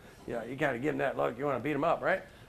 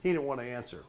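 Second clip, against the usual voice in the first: ordinary delivery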